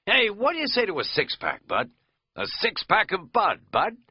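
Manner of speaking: loud, high goofy pun; jokey wheedling. Acted. Katz pushing a Budweiser joke on his friend, loud and goofy. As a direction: sell the joke with a nudge.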